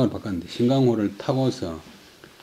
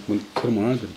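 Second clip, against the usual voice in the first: usual speaking voice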